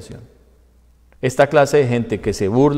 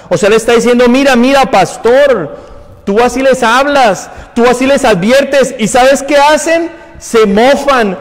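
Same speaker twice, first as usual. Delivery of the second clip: loud, raised-pitch reading from the pulpit; forceful scripture preaching; 9 semitones higher, 7 dB louder, slower than usual. Real, not acted. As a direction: proclaim God's judgment at full force. Preaches the verse at full force.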